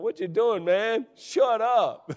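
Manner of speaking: loud, high mock "shut up"; mock friend telling him off. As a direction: tease like a friend cutting him off. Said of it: "Jay's up here going".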